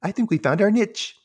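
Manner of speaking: a rough try at a cartoon voice; Ren. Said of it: "I can't do his voice very well".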